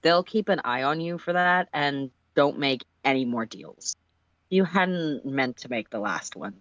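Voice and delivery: plain reading voice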